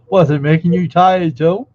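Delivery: loud mocking imitation of him asking; loud teasing mimicry of the other guy; mock question. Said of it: "Don't you yawn when he says".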